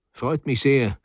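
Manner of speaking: ordinary delivery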